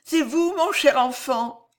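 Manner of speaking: weakened queen. A narrator's reading. Fainting queen recognises the young man.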